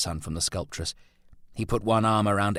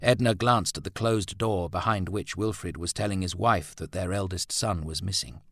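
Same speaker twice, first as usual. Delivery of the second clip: plain reading voice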